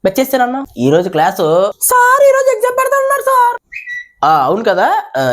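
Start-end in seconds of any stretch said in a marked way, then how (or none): 1.8-3.6 put-on high childish reminder to sir; high student voice calling sir; schoolkid voice. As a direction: pipe up like a kid tattling. Student reminds teacher of exam.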